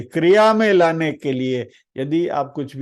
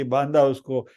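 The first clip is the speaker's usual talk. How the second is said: ordinary talk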